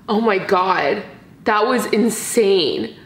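normal talking voice

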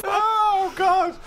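high, squealing oh God mid-laugh; laughing exclamation. Real, not acted. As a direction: gasp it out while laughing. Laughing at the absurdity.

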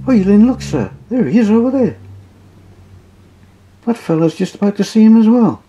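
high, hurried porter voice; lightly voiced porter; eager porter. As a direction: point him out in a rush. Porter points out the man.

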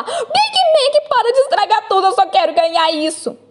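high-pitched frustrated outburst; exasperated scolding. Acted. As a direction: scold your partner, starting high and easing down. Repreende o parceiro que estraga tudo. (bronca exasperada, voz aguda)

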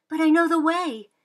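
high, loud insistence on "know"; girl insisting to father. Acted. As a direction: push back hard. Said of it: "insisted Sybil".

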